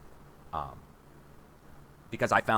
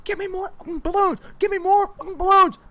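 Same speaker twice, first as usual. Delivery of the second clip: frantic scream, much higher and strained; retold mic scream; 7 dB louder than usual. Man screaming into a live mic at a campaign event, frantic yell. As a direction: yell for more balloons like everything's going wrong. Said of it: "Dude screaming in the microphone".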